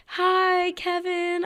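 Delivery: nervous greeting. Retold or imitated. Nervously greeting her idol.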